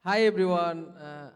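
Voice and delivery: ordinary delivery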